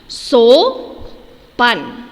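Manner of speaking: Sopan said loud and carefully; teacher showing the pronunciation of Sopan; clear model word. Shown as a demonstration. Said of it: "Sesuai dengan pengucapan kita".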